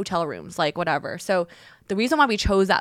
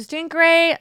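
upbeat; 9 semitones higher, 8 dB louder, slower than usual. Retold or imitated. Upbeat reply retold.

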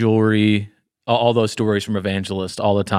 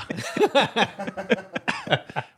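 high wheezing laugh; 10 semitones higher, 6 dB quieter than usual. Real, not acted. Laughing at own joke.

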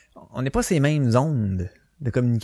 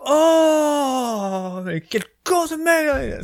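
hammed-up scene from Le dîner de cons; movie character impression; 13 semitones higher, much slower than usual. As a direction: cry it out like the film character hanging up. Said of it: "Pignon, à un moment donné, il appelle".

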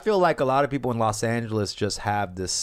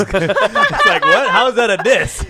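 ordinary delivery

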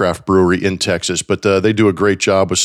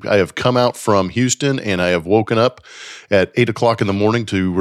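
ordinary talk

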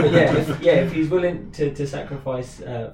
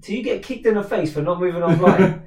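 laughing; 4 semitones higher, 7 dB louder than usual. Real, not acted. Laughing punchline.

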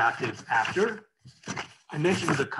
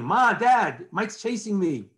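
put-on higher, louder kid brother calling for parents; retold shout; 5 semitones higher, 4 dB louder, slower than usual. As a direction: shout it like a tattling little brother. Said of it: "he starts shouting".

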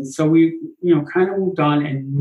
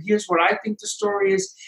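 much higher mock voice; playful, much higher imitation; mock chatter; 3 dB quieter than usual. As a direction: squeak it out like a babbling voice. Mimicking the chatter in the meeting.

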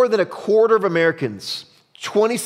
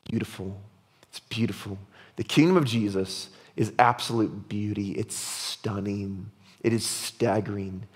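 soft, low, reverent wonder; hushed awe; 7 semitones lower, 7 dB quieter than usual. Real, not acted. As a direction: drop low and let each word sink in. Preacher dwells on the kingdom's beauty.

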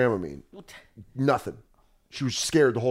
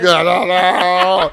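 higher, louder, very slow pleading whine; whimpering dog voice; 4 semitones higher, 8 dB louder, much slower than usual. Retold or imitated. Dog begging to get out of the car, slow high whine.

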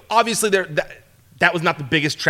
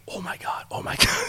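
breathless hushed disbelief; whispered amazement; 9 dB quieter than usual. Retold or imitated. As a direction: whisper it to yourself, stunned. Mimed private amazement during interview.

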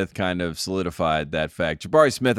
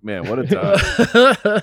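laughing; 8 semitones higher, 4 dB louder, much slower than usual. Real, not acted. Laughing after the story.

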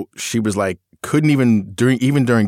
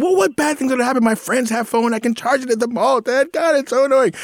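put-on much higher, whining teenage daughter; whiny teen outburst. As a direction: throw a bratty teen tantrum at dad. Daughter voiced arguing with dad.

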